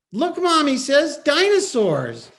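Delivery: put-on high, loud little boy; excited child. Son showing his mom the movie, high and loud. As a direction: squeal it like a delighted kid.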